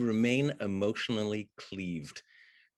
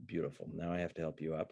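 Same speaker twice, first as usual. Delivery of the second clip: low, soft, resigned director-speak; imitating the actor, quiet; 5 semitones lower, 9 dB quieter, faster than usual. Actor coaxes his co-star through the scene, low and soft. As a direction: murmur it, lower and softer. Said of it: "And he's like".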